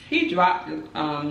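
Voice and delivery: ordinary talk, nothing marked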